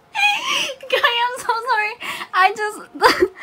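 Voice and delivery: very high, squeaky laughing voice; helpless giggly apology. Real, not acted. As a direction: apologise while cracking up. Apologises while overcome with giggles.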